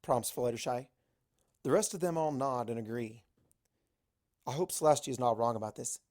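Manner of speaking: ordinary delivery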